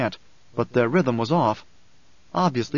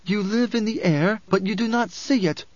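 high, sharp rebuke; 8 semitones higher than usual. A narrator's reading. Alia chides the grieving woman, sharp. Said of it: "sharpness in her voice".

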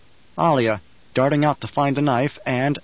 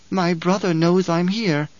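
put-on calm child Alia; 5 semitones higher, slower than usual. A narrator's reading. Alia voiced, slow.

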